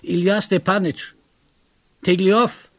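loud, high call into the fog. A narrator's reading. Narrator lost in fog calls his friend, loud. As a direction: shout his name searching. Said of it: "rief ich".

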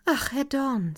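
sighing it up to him, a little helpless; Daniela's plea. A narrator's reading.

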